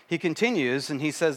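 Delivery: ordinary talk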